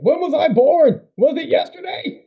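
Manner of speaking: high, loud mock outrage; sarcastic retort. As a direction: fire it back sarcastically. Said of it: "I went".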